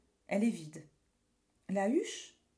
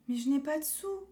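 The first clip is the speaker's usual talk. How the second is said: put-on small boy voice; 6 semitones higher than usual. Boy has no money.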